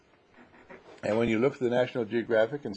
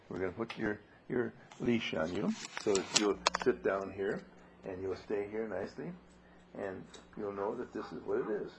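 much softer, settling the dog; quiet; 3 semitones lower, 9 dB quieter than usual. Real, not acted. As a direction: speak softly and close. Putting leash on dog.

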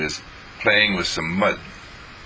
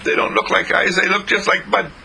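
put-on doubting little girl, high; 12 semitones higher than usual. Jean doubts.

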